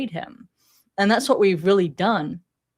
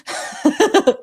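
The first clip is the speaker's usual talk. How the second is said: laugh; 9 semitones higher, 4 dB louder than usual. Real, not acted. Laughing at sounding foreign.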